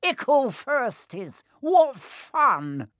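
very high, slow, mocking imp voice; gleefully wicked. A narrator's reading. Peeves mocking the first years, wicked glee. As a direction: tease them in a high, cackling voice. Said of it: "he said with an evil cackle".

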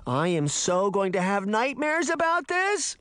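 high whine climbing through the line; whining. A narrator's reading. Daphne complaining mid-escape, whining. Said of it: "Daphne whined".

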